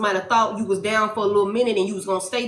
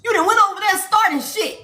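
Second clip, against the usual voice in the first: angry spirit team; 7 semitones higher than usual. Acted. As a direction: scold them like furious ancestors.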